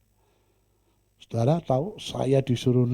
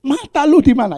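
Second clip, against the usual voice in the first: loud, high mock-angry demand; angry man; 14 semitones higher, 10 dB louder than usual. Angry man demanding where his eyes are, mock-angry loud. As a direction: snap it like the angry man again. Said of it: "orang marah itu goblok".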